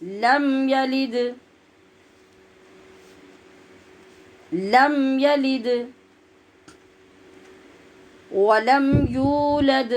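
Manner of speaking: slow, drawn-out tajweed chant; slow Quran recitation. Shown as a demonstration. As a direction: recite each word long and measured. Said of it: "recitation demo".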